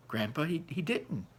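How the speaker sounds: usual speaking voice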